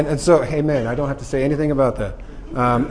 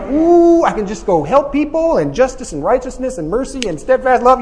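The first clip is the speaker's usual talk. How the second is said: mock excitement; 7 semitones higher than usual. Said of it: "You're like".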